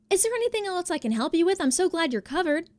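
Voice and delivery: mock the rep's fake cheer; chirpy phone rep. Mocking the rep who ignored her, chirpy customer-service voice.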